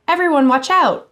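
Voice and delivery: loud high warning shout; alarmed high-pitched yell. A narrator's reading. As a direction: yell it out to warn everyone. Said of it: "yelled Happy".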